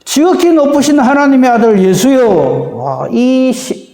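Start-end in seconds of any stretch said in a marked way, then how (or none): 0.0-2.3 raised voice, hailing Jesus from afar; loud proclamation. Acted. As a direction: call it out high and strong. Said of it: "큰 소리로 이렇게 말합니다". (크게 외치듯이)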